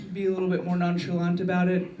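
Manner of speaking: ordinary talk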